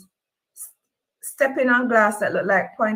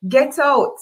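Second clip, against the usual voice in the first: loud order; 5 semitones higher, 4 dB louder than usual. A narrator's reading. Ordering her out.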